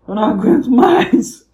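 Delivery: slow, high, loud wail of despair; despairing groan. Acted. Office worker at the clock, wailing slowly in despair. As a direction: drag out the words in despair.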